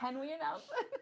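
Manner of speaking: high question bubbling with laughter; laughing, high-pitched excitement; giddy question. Real, not acted. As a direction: ask it through a laugh. Co-host giddy about revealing news.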